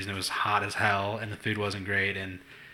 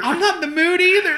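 put-on much higher, louder, far-off kid voice; mock childish whine; 7 dB louder than usual.

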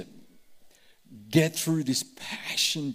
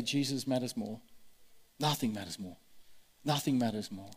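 quiet declaration; 4 semitones lower, 8 dB quieter than usual. Shown as a demonstration. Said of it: "keep going and say".

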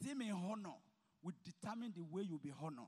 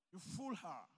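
ordinary delivery

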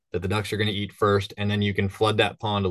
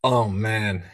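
ordinary talk, nothing marked

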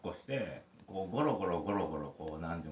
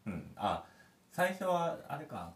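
ordinary talk, nothing marked

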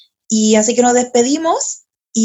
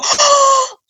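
high, drawn-out acted gasp; retold gasp of astonishment; shocked gasp. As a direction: gasp as if stunned by the news. Acts out shocked reaction to message.

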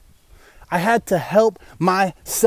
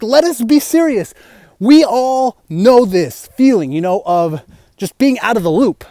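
usual speaking voice

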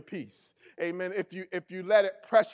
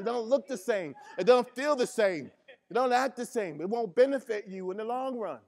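louder, driving sermon delivery; full-force preaching; 4 semitones higher, 5 dB louder than usual. Acted. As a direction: preach it hard to the congregation.

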